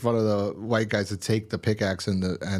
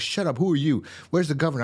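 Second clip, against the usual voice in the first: higher, retold hostile snap at the woman; mock angry snap; 6 semitones higher than usual. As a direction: bark it at her like a thug, half-acted. Martinez snaps at Andrea.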